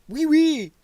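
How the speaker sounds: sing-song mock French accent, mocking French lefties; over-the-top fake French accent. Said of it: "wrong accent".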